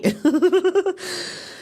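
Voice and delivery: high-pitched burst of laughter; real laugh. Real, not acted. Amused after imitating the Aussie rally chant, high laughing.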